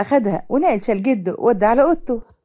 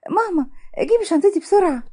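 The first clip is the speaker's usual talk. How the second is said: raised voice; 7 semitones higher than usual. A narrator's reading. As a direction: call urgently for help, pitched up. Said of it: "وبصوت عالي". (نداء مستعجل بصوت عالي)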